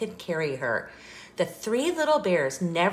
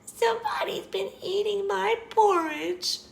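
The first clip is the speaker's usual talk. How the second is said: very high, squeaky cub voice; Baby Bear's squeak; 14 semitones higher than usual. A narrator's reading. Baby Bear finds his bowl empty, high squeak. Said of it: "he squeaked".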